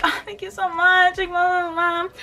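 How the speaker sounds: high, slow, teary thank-you; tearful thanks. Retold or imitated. Fan thanking [name] for the poster, tearful. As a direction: thank her on the edge of tears. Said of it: "And I was like".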